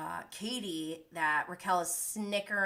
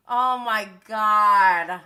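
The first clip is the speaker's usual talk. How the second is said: slow, loud, stretched-out oh my God; loud drawn-out disbelief; 11 dB louder, much slower than usual. Real, not acted. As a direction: let it out loudly and slowly, stunned. Reacting in disbelief to show.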